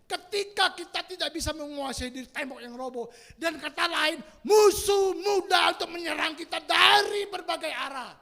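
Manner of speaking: loud, high, insistent sermon delivery; full-force preaching. Real, not acted. Preacher to congregation on losing self-control, full-force preaching. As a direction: press each point on the congregation.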